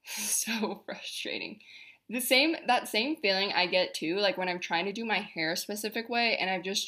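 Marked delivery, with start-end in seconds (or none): 0.1-1.6 a soft exasperated laugh through the words; laughing quietly. Real, not acted. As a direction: laugh it off under your breath.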